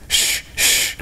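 Taffy's father voices the spotty snake, soft hissing shh; soft, voiceless "shh, shh". Acted. Planning a picture of a spotty snake, hissing. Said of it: "draw a spotty snake that hisses".

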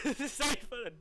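high, loud giggly outburst; laughing delight. Real, not acted. Gamer having a great time mid-match, laughing. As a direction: laugh through it, thrilled.

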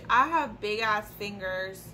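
usual speaking voice

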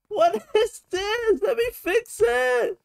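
very high, slow, laughing squeak; high squeaky voice. Pretend. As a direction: squeak it out through a giggle.

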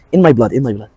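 ordinary talk

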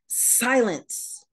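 high, ringing command to the storm; commanding Jesus. A narrator's reading. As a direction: order the wind to silence. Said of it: "he commanded".

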